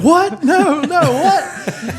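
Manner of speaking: high, loud stunned what, breaking into a laugh; can't believe what he just heard; shocked disbelief. Retold or imitated. Boyfriend on the chairlift hearing he's been dumped, high loud shock. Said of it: "And he's like".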